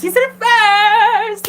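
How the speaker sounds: high, slow, squealed gush; giddy. Real, not acted. Gushing about boyfriend.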